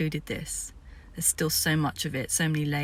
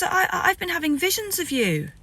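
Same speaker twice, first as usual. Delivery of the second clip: high-pitched, stammering awe; awed chief; 11 semitones higher than usual. Retold or imitated. The chief tells her of his visions, high and stammering.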